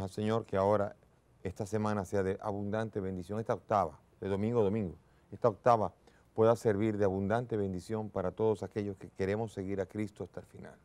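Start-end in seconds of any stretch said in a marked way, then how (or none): none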